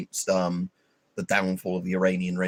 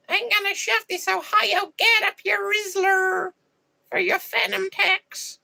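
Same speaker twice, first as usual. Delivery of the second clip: very high, slow cartoonish kid voice; Gen Alpha kid; slower than usual. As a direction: squeak it out like a brain-rot child. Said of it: "the Gen Alpha age ones".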